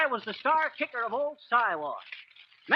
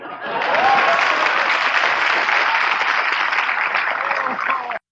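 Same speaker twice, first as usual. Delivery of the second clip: hearty laugh, much louder; long loud laugh; 4 semitones higher, 8 dB louder than usual. Real, not acted. Pleased with his own patter, laughing out loud. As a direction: roar with laughter at your own brag.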